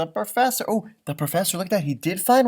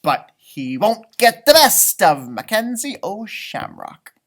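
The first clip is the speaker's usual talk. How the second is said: higher, louder, slow bravado; defiant leprechaun boast; 6 semitones higher, 9 dB louder, slower than usual. A narrator's reading. Mackenzie boasting he'll outwit the professor. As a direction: puff up like a proud leprechaun.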